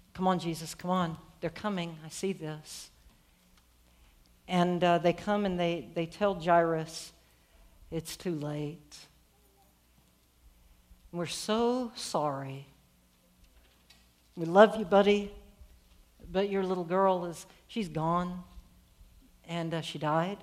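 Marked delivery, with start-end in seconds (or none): 0.1-2.9 low, soft anxious plea; hushed urging. Acted. Dreading father sees messengers approach. 7.9-20.4 slow, lower, tender delivery of bad news; gentle condolence. Friends break news of child's death.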